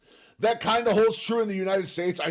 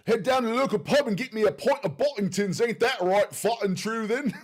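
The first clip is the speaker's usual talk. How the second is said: higher, badly faked British pub accent; hammed-up, amateurish attempt at UK English; poor British accent; 5 semitones higher than usual. Shown as a demonstration. Streamer shows off a very poor British accent. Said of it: "I can do a very poor British accent".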